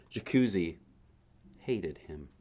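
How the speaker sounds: ordinary delivery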